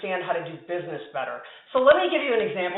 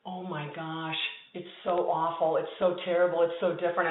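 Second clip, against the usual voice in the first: quiet, lower mock lament; subdued dismay; 3 semitones lower, 6 dB quieter than usual. Friend confiding over lunch about his awful new job, hushed dismay. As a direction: retell it hushed, as if overwhelmed.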